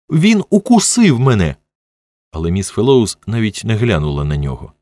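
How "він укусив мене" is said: high-pitched angry wail; shrill, outraged complaint; furious child's cry. A narrator's reading. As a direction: yell it like a furious little boy. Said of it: "розлючено кричав Джері".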